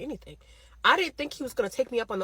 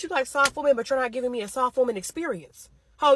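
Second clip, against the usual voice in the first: ordinary talk, nothing marked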